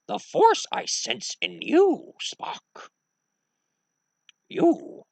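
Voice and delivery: high, slow creature voice; halting, high-pitched alien mentor; old Jedi master. Acted. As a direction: ponder aloud like a tiny ancient sage. Old Jedi master accepts a pupil.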